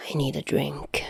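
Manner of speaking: tired huffing whisper; huffed whisper. Acted. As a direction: sigh it out under the breath. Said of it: "Chuya huffed".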